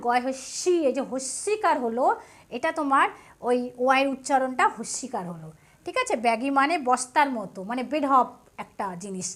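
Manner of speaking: ordinary talk, nothing marked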